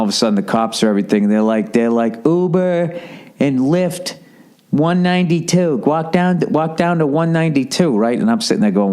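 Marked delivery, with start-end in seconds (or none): 2.3-8.0 higher, slow mock cop call. Cops shouting directions to rideshare crowd, mock voice. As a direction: bark out the cops' directions lightly.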